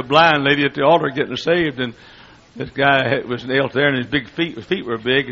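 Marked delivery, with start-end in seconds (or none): none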